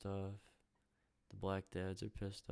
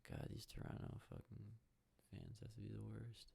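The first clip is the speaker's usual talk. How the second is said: whispered grumble; 9 dB quieter than usual. Real, not acted. Grumbling about Toronto fans.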